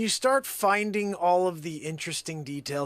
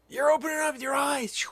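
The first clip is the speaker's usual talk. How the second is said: excited; 11 semitones higher, 4 dB louder than usual. Acted. Hype continues.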